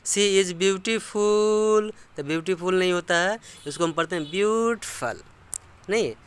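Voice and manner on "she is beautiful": "She is" said apart, "beautiful" as "bootiful", slow; slow Indian English reading, each word separate. Shown as a demonstration. Teacher contrasting Indian English pronunciation, slow. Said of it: "अपना Indian English बोलेंगे तो बोलेंगे".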